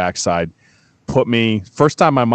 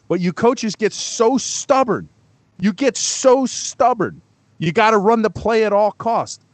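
heated rant; 11 semitones higher than usual. Real, not acted. Ranting at stubborn coaches.

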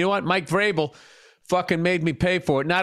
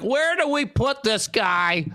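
mock sportswriters. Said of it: "They're like".

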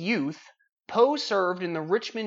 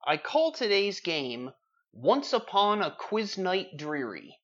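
grand announcement; 4 semitones higher, slower than usual. Acted. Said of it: "host announces the game title".

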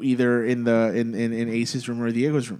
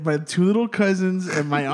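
ordinary talk, nothing marked

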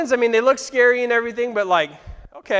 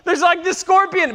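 mock panic; 9 semitones higher, 3 dB louder than usual. Panicked plea during vision.

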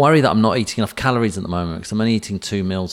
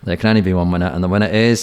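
award announcer build-up; 6 dB louder, faster than usual. Pretend.

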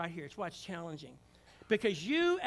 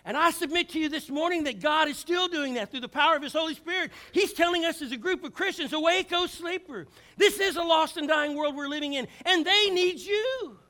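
louder, higher climax of the sermon; full-force preaching; 8 semitones higher, 9 dB louder than usual. Acted. Preacher urging the church to wake up, full force. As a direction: build it to a passionate peak.

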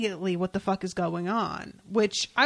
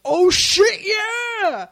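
loud, high, thrilled yell; excited shout; 11 semitones higher, 9 dB louder, much slower than usual. Retold or imitated. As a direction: shout it out with a laugh. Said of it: "I was so excited".

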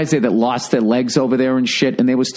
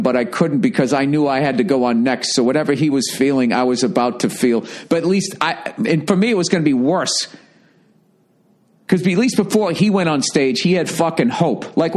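ordinary delivery

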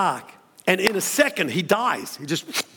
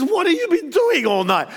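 higher, half-laughing disbelief at the hen; amazed question; 9 semitones higher than usual. Retold or imitated. Obed-Edom to the hen, higher amazed disbelief. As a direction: gape at the pile of eggs.

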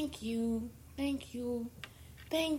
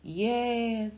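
ordinary talk

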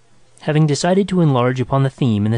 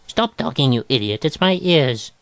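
exasperated patient; mildly acted exasperation; irritated. A narrator's reading. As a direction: cut the doctor off impatiently. Irritated patient cuts off doctor.

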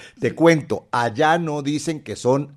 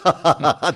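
laughing; 2 semitones higher, 3 dB louder than usual. Real, not acted. Laughing at the joke.

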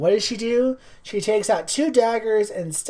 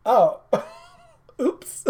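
giggly mock oops; 3 semitones higher than usual. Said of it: "everyone's like".

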